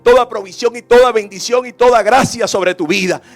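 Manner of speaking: loud, driving sermon delivery; full-force preaching. Acted. Preacher urging the congregation to choose service, forceful preaching. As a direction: press each promise home with force.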